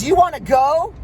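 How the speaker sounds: mock road-rage challenge, rising at the end; yelled challenge. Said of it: "he's yelling at me".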